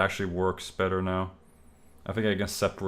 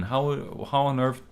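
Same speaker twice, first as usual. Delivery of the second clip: ordinary delivery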